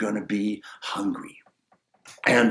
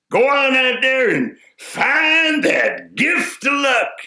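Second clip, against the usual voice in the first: louder, higher, slow call; 13 semitones higher, 4 dB louder, slower than usual. Acted. Wise man sending the simple man off, calling out. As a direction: send him off with a big call.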